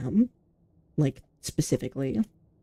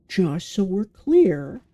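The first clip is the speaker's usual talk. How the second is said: slow and louder, drawn out; slow, emphatic clarifying; 4 semitones higher, 7 dB louder, much slower than usual. Retold or imitated. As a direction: spell it out slowly and firmly. Quotes own careful disclosure.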